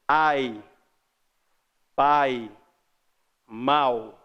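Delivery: "Ai", "pai", "mal" each one beat, falling off the A; spaced one-syllable diphthongs "Ai", "pai", "mal"; strong A, weak glide. Shown as a demonstration. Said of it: "O A é mais forte que o I".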